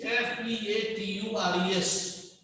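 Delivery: softly spelled letter by letter; quiet, measured spelling for note-takers. Real, not acted. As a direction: dictate each letter separately and quietly. Spells the word for students.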